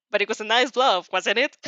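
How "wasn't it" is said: plain reading voice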